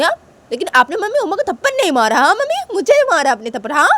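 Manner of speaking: loud, high complaint of unfairness; acted wronged child; aggrieved protest. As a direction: accuse your mother, hurt and indignant. Sanju protests being slapped.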